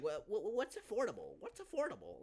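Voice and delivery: very high stammering put-on voice; hammed-up dim client voice; mock confused client. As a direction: squeak the question like a clueless buyer.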